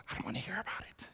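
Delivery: quick, whispered aside; whispered plea. Acted. Child begging Dad not to tell, whispered. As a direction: rush it out under the breath.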